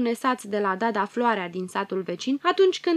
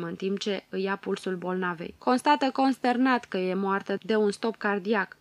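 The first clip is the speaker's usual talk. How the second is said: ordinary delivery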